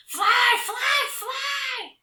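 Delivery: very high loud troll shout. Acted. Trolls shout as they push her off, very high and loud. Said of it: "they shouted at me".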